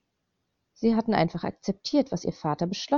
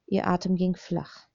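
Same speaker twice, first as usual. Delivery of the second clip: plain reading voice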